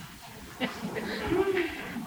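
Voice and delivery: high, bright burst of laughter; amused laugh. Real, not acted. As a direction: laugh at your own joke. Teller laughs at sandstone joke.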